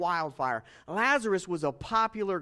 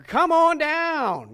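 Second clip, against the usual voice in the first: slow, higher, louder game show host call; Bob Barker; 7 semitones higher, 7 dB louder, much slower than usual. Retold or imitated. Joking that Jesus sounded like a game show host, slow hammed-up announcer call. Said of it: "didn't sound like Bob Barker".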